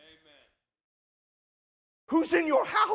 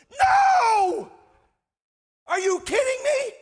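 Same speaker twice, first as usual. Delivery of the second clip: incredulous outburst; 11 semitones higher, 6 dB louder than usual. Real, not acted. Preacher explodes rejecting the misreading.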